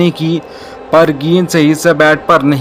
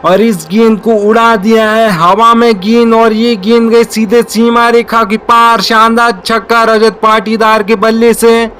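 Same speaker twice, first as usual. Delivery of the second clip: excited cricket commentary; 4 semitones higher than usual. Real, not acted. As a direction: build up as the ball flies. Live call of a six.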